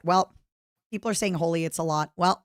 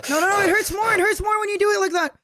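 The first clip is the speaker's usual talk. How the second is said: pained; 13 semitones higher, 3 dB louder than usual. Real, not acted. Burning pain from the hot sauce.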